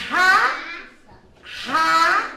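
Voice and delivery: loud, stretched "Ra, Ra," said high; sounding out. Shown as a demonstration. Teacher checks the word "raposa" with a child. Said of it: "Então vamos ver?".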